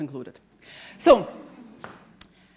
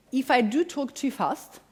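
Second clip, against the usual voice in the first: ordinary delivery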